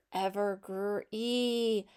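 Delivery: "ever, gr, e" built up in parts, ending on long ee; sounded out. Shown as a demonstration. Teacher leading to the next sound, sounded out in pieces. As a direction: break "ever, gr, e" apart and hold the final ee. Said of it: "practice sounding out".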